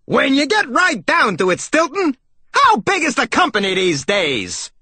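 high, brash salesmouse holler; big, high-pitched cartoon voice. A narrator's reading. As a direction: blare the question like a pushy salesman. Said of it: "Shifty only got louder".

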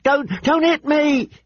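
high, slow, terrified begging; shrill pleading. Acted. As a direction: beg in a shrill panicked voice. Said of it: "he shrilled".

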